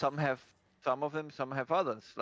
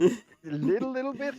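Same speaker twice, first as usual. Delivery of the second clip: mocking voice; 11 semitones higher, 7 dB louder than usual. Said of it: "did you blame yourself a little bit".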